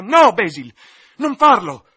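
desperate loud shout. Acted. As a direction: scream it to stop him. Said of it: "gridò".

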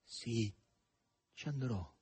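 soft, unadorned reply; simple assent. A narrator's reading. Margherita gives in to Azasello, simply and softly. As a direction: agree quietly, with a pause. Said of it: "rispose semplicemente Margherita Nikolaevna".

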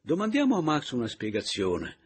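loud, projected proposal to the room; persuading aloud. Acted.

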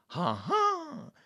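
high-pitched "aha!" of discovery; triumphant exclamation. A narrator's reading. Detective finds the hidden item, exclaiming in triumph. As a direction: leap up high as if catching someone out. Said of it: "esclamò e rivolgendosi a Monsieur Bouc".

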